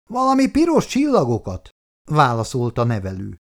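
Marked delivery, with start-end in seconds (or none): 0.1-1.7 higher, uncertain carer's reply; carer answering. Acted. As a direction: pass it on, still unsure.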